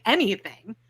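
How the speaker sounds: ordinary talk, nothing marked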